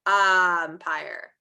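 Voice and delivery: "Umpire" with a loud, lengthened "Um"; stressed first sound. Shown as a demonstration. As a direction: lean on the start of "Umpire". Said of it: "if you think it begins with".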